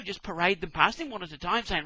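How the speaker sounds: usual speaking voice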